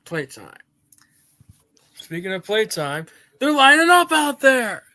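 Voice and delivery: gleeful villain toy shout. Acted.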